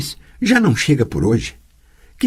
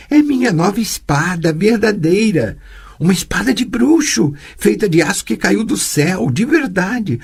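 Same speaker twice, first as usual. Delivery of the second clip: put-on lightly raised, eager young girl; child Ciri voice; 9 semitones higher than usual. Girl boasting to her teacher about her sword, lightly higher child voice. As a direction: show off the new sword like a proud kid.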